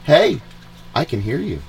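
normal talking voice

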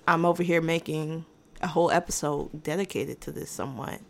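usual speaking voice

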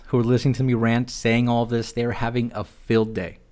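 ordinary talk

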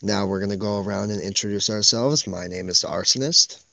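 low, unhurried podcast intro voice; ASMR voice. Pretend. Host welcoming listeners to the pilot episode, low ASMR voice. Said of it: "that A S M R voice".

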